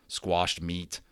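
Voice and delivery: usual speaking voice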